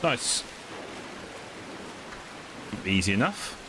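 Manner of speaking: normal talking voice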